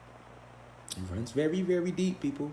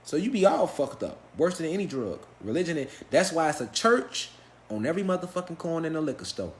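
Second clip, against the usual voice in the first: ordinary talk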